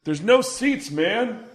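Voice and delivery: high, far-off call from the back of the room; guy calling from the back. Retold or imitated. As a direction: shout back from the crowd, a little lost. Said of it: "from the back room you hear".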